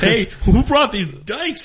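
mock dad voice. Retelling his crude dad voiced to friends. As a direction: do a lightly hammed-up crude father. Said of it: "that would've been".

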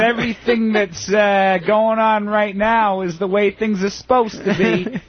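high, slow, meek worker; resigned worker voice. Pretend. Playing a worker who accepts everything, high and slow. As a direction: sigh it out like a doormat.

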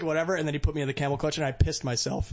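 ordinary delivery

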